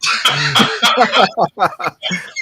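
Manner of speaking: roaring laugh; loud burst of laughter. Real, not acted. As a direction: laugh out hard at the joke. Big laugh at joke.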